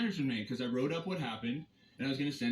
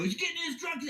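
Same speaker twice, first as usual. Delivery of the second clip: mocking the guard; 13 semitones higher than usual. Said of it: "we're going on the radio".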